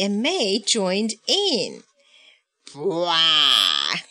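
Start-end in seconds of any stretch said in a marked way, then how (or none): 2.7-4.0 low, loud-edged creature grunt; low straining Totoro grunt; big grunt. A narrator's reading. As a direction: heave out a drawn-out "bwah" like a big beast. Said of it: "grunted Big Totoro".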